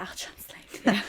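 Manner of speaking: slow whispered offer; whispered aside. Retold or imitated. Offering to translate George for Rosella, whispered. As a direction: murmur it slowly like a secret. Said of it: "I was like".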